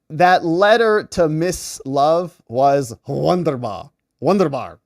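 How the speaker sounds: loud, slow attempt at a German accent; badly faked German accent, drawn out. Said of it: "I gotta work on my German accent".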